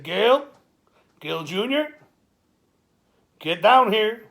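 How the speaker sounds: loud, high call up the stairs; calling upstairs. Retold or imitated. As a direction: yell for her to come down. Calling his daughter upstairs.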